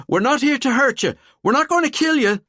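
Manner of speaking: high, loud shout over screaming. A narrator's reading. Valkyrie shouting over the terrified pair's screams. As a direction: yell to cut through the panic. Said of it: "Valkyrie shouted over their screams".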